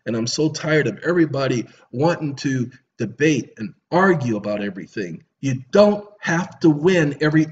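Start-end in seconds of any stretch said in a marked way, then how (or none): none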